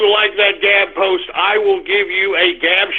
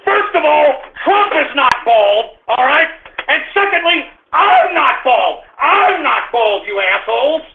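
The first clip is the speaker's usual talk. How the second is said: irritated denial; 6 semitones higher than usual. Real, not acted. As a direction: insist hard, fed up. Denies being bald, irritated.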